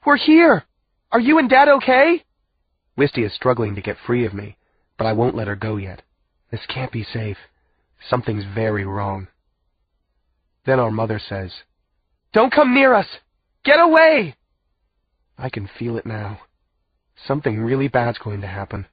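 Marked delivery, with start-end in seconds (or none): 0.1-2.2 loud, high, urgent shout; calling out. A narrator's reading. Daughter calling to her captive parents, shouting. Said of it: "Wisty calls into the dark". 12.3-14.3 high, loud desperate yell; shouted warning. A narrator's reading. Captive mother warning her children away, shouting.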